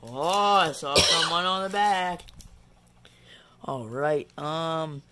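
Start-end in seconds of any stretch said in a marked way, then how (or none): 0.0-2.2 surprised. Real, not acted. Surprised at the card back.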